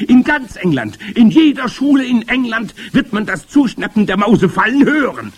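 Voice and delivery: high, loud, racing gloating rant; excited high-pitched witch tirade; grand witch. A narrator's reading. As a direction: whip yourself up into a witch's frenzy.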